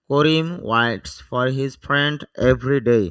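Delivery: slow model reading. Shown as a demonstration. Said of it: "teacher reads model sentence slowly".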